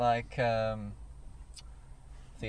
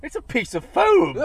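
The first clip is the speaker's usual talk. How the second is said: high, loud, hammed-up shout of the line; loud catchphrase; 11 semitones higher, 12 dB louder than usual. To his co-host, a high hammed-up shout of the catchphrase.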